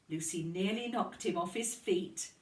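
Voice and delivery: usual speaking voice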